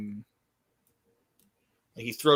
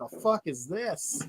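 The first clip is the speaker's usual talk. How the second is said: high, soft bewildered question; lost newcomer looking around, pitched up; 6 semitones higher, 6 dB quieter than usual. Retold or imitated. Retelling the wrestler's confusion, soft and high. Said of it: "he's just looking around like".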